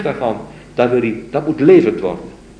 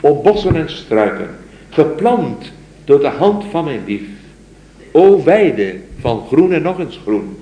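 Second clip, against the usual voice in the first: raised, slow solemn recitation; poem recital; 4 semitones higher, 6 dB louder than usual. Acted. Lecturer reciting the mystic's poem to his students, solemn recital. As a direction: recite it like a devoted lover's plea.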